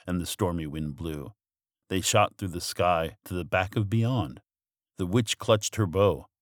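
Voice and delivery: ordinary delivery, nothing marked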